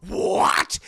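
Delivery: loud, explosive outraged cry; hammed-up villain outrage; Joker infuriated. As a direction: burst out in mock fury. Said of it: "he's infuriated by what he sees".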